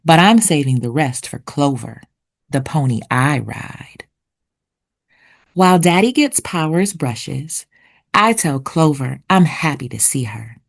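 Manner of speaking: ordinary delivery, nothing marked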